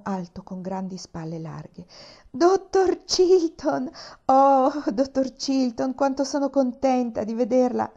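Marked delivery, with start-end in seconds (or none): 2.3-7.9 high, loud, slow cry of delight; a girl bursting with joy at seeing him; joyful exclamation. A narrator's reading. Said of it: "esclamò Pollyanna felice".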